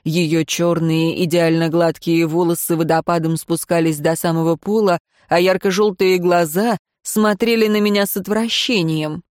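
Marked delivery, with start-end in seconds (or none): none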